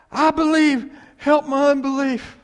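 desperate cry at full volume, pitched high. Acted. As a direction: shout it as an impassioned plea to Jesus. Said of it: "the man cried out".

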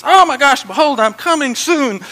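high, loud outburst; excited shouting. Acted. Preacher acting out Jesus telling us he's coming, excited shout. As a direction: burst out as if you can't hold it in. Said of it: "he just shouting. He's excited about this".

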